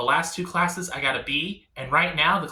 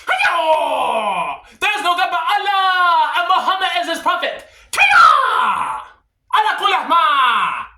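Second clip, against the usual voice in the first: much higher, louder shouted mock war cry; ninja battle cry; 11 semitones higher, 7 dB louder, slower than usual. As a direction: scream it like a charging ninja.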